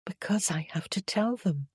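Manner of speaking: breathless whispered confession; barely voiced, soft and unvoiced. Annabel tells her lover she must face her family, whispered. Annabel answers Magnus softly.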